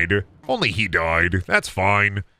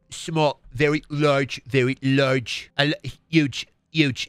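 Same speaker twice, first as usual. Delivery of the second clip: put-on, pursed small-mouth voice; Trump; slower than usual. Performer relents into Trump, pursed-mouth voice. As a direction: drawl single boastful words through a small mouth. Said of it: "Trump voice?".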